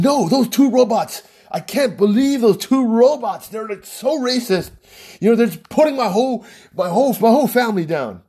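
high-pitched imitation of an outraged friend; pissed off. Offended friend objects to two movie robots, pissed off. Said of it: "So he was just pissed".

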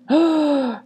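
sharp, high gasp of alarm; startled gasp. Acted. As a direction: gasp at being nearly caught. Startled as Richard approaches.

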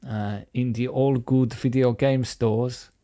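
hammed-up German accent; mock German. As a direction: fake German with lots of äh. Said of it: "like a stereotypical Dutch person".

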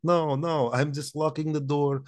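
put-on comrade host with a thick Russian accent. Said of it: "My, my Russian accent".

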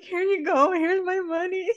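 high, wailing mock sob voice; hammed-up blubbering at the register; mock bawling. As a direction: imitate a crying customer paying, voice way up. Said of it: "fucking bawling".

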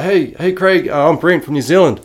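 high, eager fan hello; excited greeting. Retold or imitated. Young fan greeting his snowboard idol, high and excited. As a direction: gush a nervous hello to your hero. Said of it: "I was like".

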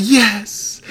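high, eager mock yes. Husband mimics his eager reply to wife. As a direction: chirp it like a smitten fool.

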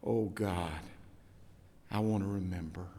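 soft, low whispered-like plea; hushed prayer. Real, not acted. Preacher praying aloud to God, hushed and low. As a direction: pray it quietly to God.

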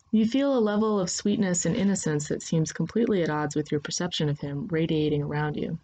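plain reading voice

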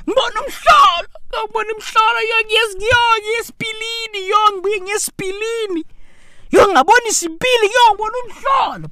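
high, fast, frantic yelling in Zulu; frantic spazzing man. Retold or imitated. Driver on a call to his wife, frantic high yell. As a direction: mimic a panicked man yelling on the phone.

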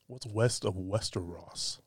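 slow, drawn-out tongue twister, mocking the dare to say it fast; deliberately slow. Shown as a demonstration. Host saying the Arya line slowly right after daring himself to say it fast. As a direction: take it slowly, word by word. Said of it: "Say that five times fast".

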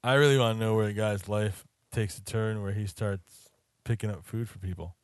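ordinary delivery

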